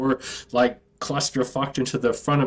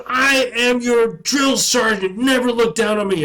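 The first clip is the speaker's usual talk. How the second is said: drill sergeant yell; 7 semitones higher, 4 dB louder than usual. Retold or imitated. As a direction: spell it out to a recruit. Drill sergeant yelling at recruit.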